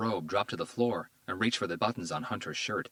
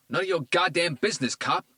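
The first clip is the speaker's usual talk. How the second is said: higher, louder defiant shout; hostile shout; 10 semitones higher, 6 dB louder than usual. A narrator's reading. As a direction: spit it at the cop. Tito yells at cop.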